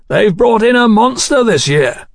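shouting. A narrator's reading. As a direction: keep calling above the racket. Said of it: "Godwin shouted".

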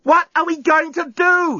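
very high, drawn-out wail; panicked yell. A narrator's reading. Lucy yells at the journalist about the bomb, panicked. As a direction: yell it in desperation. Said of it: "she yelled".

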